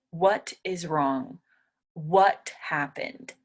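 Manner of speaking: "What is wrong?" linked slowly, no hard T; slow, flowing. Shown as a demonstration. Teacher modelling a relaxed slow question. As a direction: glide through "What happened?" slowly. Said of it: "I would just try and say".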